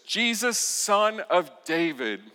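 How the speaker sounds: high, loud call, slowing on "Son of David". Acted. Beggar calling Jesus again over the hushing crowd, loud. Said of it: "talks even louder".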